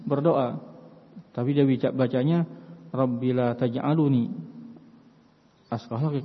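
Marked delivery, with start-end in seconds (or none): 2.9-6.2 taj'aluni with u inserted after the l; wrong indicative reading. Shown as a demonstration. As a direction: say taj'aluni with a full lu before ni. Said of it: "Ini keliru".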